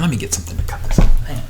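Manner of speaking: quick whisper to himself; whispered aside. Real, not acted. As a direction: hush it, fast and off to the side. Steps away mid-video, aside.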